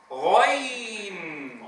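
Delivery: غين drawn out very slowly; teacher reading غين slowly for students; model letter name. Shown as a demonstration. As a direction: stretch غين long and steady. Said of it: "تعالوا لنقرأه".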